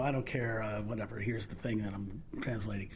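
low, offhand and uncaring; bored indifferent. Retold or imitated. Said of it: "the dramatic with the, I don't, you know".

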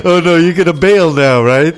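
loud, laughing teasing; laughing tease. Real, not acted. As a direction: tease her through laughter. Teasing co-host over alarm.

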